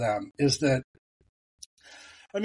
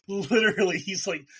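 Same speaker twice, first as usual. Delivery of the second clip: ordinary delivery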